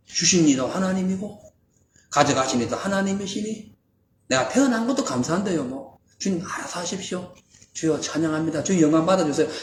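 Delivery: soft, quiet reverent surrender; humble prayer. Acted. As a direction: speak it gently, trusting. Job's faithful words voiced.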